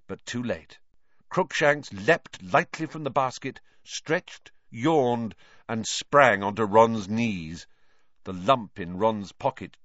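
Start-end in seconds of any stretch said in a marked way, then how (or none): none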